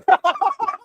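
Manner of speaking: high burst of laughter; cracking up at the punchline. Real, not acted. Laughing at Kobe's prank on Pau, high and loud.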